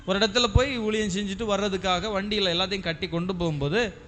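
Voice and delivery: ordinary talk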